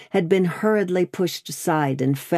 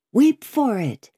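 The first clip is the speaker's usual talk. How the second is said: higher, rising-intensity "Weep for it"; fervent, pitched-up imploring; impassioned plea; 6 semitones higher, 3 dB louder than usual. Acted. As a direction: lift the voice high and urge it. Daughter repeats her plea with more force.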